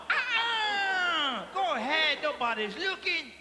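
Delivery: high, coy refusal then coaxing; coy wife. Retold or imitated. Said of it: "She's going".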